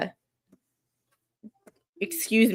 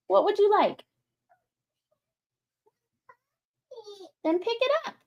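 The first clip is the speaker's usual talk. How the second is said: high, soft coaxing voice; 12 semitones higher, 3 dB quieter than usual. Real, not acted. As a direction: coax a little one gently. Speaking to a small child off-camera.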